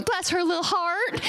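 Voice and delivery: mock Southern sweetness; sweet Southern voice. Southerners softening an insult, sugary Southern accent. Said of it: "make it sound real sweet by going".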